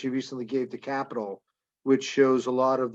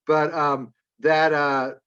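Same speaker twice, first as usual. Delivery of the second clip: usual speaking voice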